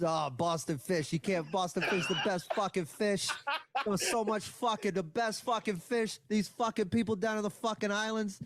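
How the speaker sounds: put-on ranting local in a thick Boston accent; proud, profane Boston accent rant. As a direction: put on a Boston accent, defending home turf. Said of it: "one of the best Boston accents".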